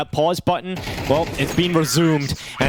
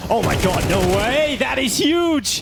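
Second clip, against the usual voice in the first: shrieking jackpot winner; 9 semitones higher than usual. Real, not acted. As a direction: gasp it out in disbelief. Caster reacting to a play.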